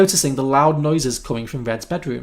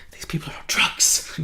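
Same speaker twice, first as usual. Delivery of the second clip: whisper; 4 dB quieter than usual. Real, not acted. Aside whispered to co-host.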